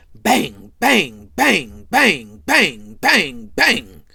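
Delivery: very loud repeated bangs, evenly spaced; banging. Retold or imitated. Gerard Senior joking about making Junior, loud rhythmic bangs. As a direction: punch out each bang like a blow. [name] acting out lewd banging.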